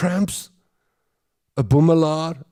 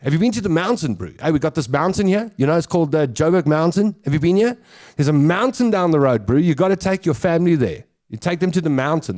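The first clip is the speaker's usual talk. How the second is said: brash, quick Joburg bro voice, higher; 3 semitones higher, faster than usual. Retold or imitated. Comic showing Joburg bro bragging about their mountain. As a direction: sell it like an eager Joburg oke. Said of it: "That would be Joburg".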